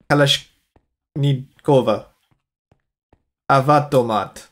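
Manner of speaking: slow, lightly put-on Russian accent. Shown as a demonstration. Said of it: "in a Russian accent".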